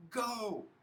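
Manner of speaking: high, loud urging yell. A narrator's reading. Hobbin sends his relay teammate off, yelling. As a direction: shout it to send a runner off. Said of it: "Hobbin yells".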